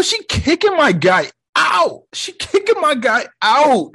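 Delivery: high, drawn-out shout of disbelief; incredulous high-pitched exclaiming; comic outrage. Real, not acted. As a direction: holler it up high for a dumped friend.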